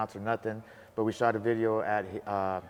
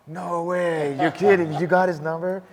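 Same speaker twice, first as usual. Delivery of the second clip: high, loud, thrilled no-way; can't believe his luck; stunned disbelief; 7 semitones higher, 7 dB louder, slower than usual. Retold or imitated. Telling his wife he can't believe she got the number, in loud high disbelief.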